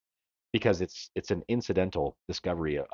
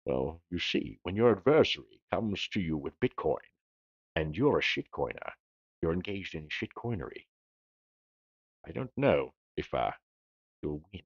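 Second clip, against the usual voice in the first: low, halting Scottish movie-star impression; Sean Connery; 3 semitones lower, 3 dB quieter than usual. Host obliging a viewer's request, mock Scottish star accent. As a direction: put on a soft, deliberate Scottish burr. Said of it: "say it in a Sean Connery accent".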